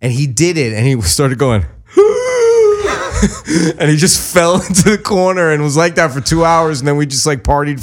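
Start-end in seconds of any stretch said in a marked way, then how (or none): 1.9-3.7 hammed-up high-pitched wail, laughing; mock wail far above normal pitch. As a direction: imitate his strung-out cry. Mimicking friend's cry.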